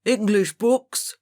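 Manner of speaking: put-on stern old schoolmaster shouting; shouted order. A narrator's reading. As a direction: snap the order at the class. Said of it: "shouted Mister Greville".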